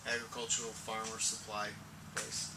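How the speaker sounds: ordinary talk, nothing marked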